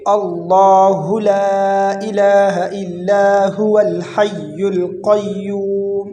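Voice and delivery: slow joined-up tajweed recitation, long vowels; connected recitation. Shown as a demonstration. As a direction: recite the phrase through, stretching the vowels. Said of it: "یہ پڑھیں گے". (ملا کر تجوید سے تلاوت)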